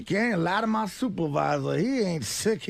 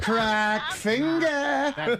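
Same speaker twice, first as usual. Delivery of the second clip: slow savouring drawl; 4 semitones higher, much slower than usual. Acted. Savours the nickname.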